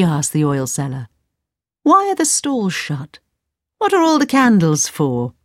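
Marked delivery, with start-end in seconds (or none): 1.8-5.3 mother, puzzled. Acted. Mother questions oil seller.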